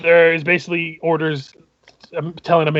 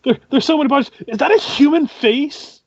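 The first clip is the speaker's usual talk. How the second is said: horrified guard; 10 semitones higher than usual. Acted. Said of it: "sounds of someone being like".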